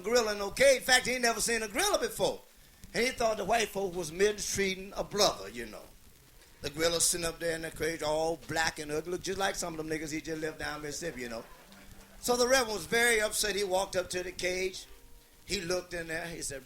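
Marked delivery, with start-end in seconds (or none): none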